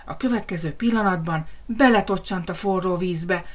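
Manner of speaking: plain reading voice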